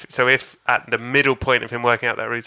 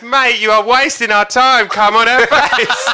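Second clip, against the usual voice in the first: slow, high, loud complaint; impatient heckler; 9 semitones higher, 7 dB louder, slower than usual. Retold or imitated. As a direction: shout each word slowly at the stage. Impatient bloke heckles comedian.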